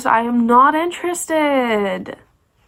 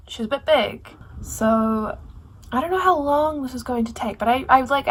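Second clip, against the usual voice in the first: ordinary talk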